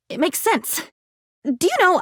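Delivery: ordinary delivery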